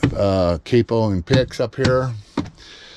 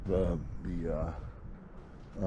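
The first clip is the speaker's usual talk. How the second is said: ordinary talk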